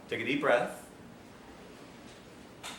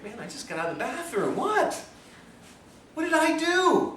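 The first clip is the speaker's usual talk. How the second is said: mock confusion; 10 semitones higher than usual. Retold confused reply.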